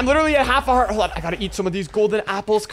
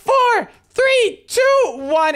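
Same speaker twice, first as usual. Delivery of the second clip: excited countdown; 11 semitones higher, much slower than usual. Real, not acted. Final countdown to win.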